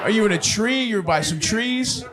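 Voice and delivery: usual speaking voice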